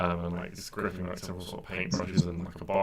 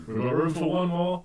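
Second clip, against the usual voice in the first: higher, louder host's invitation; calling out; 10 semitones higher, 6 dB louder than usual. Acted. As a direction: call out to fill the last seat. Host calling out for one more.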